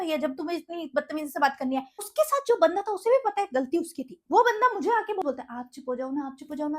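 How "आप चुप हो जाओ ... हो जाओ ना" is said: soft pleading quote. Retold or imitated. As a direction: mimic his soft, coaxing plea to stop. Guy pleads her to stop. (नरमी से मनाती नकल)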